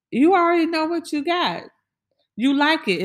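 high sassy call-out; teasing. Real, not acted. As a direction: tease them knowingly. Teasing listener.

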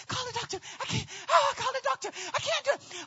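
high, strained panting plea; barely able to speak. Retold or imitated. Woman in labor begging husband, mock breathless plea. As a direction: gasp it out in acted agony. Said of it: "I couldn't even speak".

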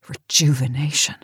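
a scornful breath of a word; bitter whisper. Acted. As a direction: whisper it to yourself with contempt. Janice alone, repeating Bill's insult.

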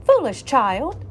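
scolding. Acted. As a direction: scold the child. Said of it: "she scolded".

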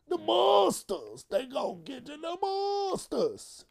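very high, drawn-out mock shout of monsters; hammed-up horror voice drawing out the monsters; high stretched-out spooky cry; spooky monster taunt. Spooky monster warning bit.

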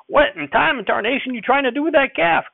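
put-on high, loud old man's shout. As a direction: yell across the barn. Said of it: "Grandfather shouted".

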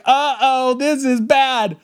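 high, slow, worried "this is bad"; alarmed. Alarmed realization in crowd.

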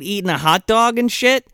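ordinary delivery

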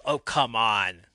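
loud, drawn-out heckle; mock crowd yell. Crowd to a stalling wrestler, mock yell. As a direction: imitate an impatient crowd shouting slowly. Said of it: "Crowd yells".

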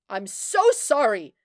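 loud, urgent apology; shouted apology. A narrator's reading. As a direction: shout it into the receiver. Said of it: "shouted into the receiver".

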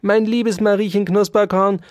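indignant grumbling. A narrator's reading. Dauerspeck calls the cheek pouches sunken cheeks, indignant. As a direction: correct the mouse testily, voice pushed up. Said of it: "knurrte Ambrosius Dauerspeck".

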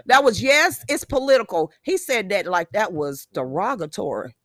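normal talking voice

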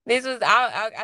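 stoned giggle. Real, not acted. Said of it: "I think I'm high now too".

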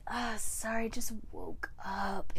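soft, groggy, dragging mumble; mock sleepy voice, slow at the end; just-out-of-bed voice. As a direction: imitate someone barely awake on the phone. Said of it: "talking to me in this voice, like, he just got out of bed".

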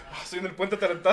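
put-on laughing kid voice.

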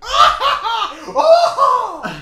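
loud, very high imitated scream; freak-out scream. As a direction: shriek as if freaking out. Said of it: "like, freak out. He'd be like".

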